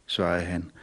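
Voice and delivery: usual speaking voice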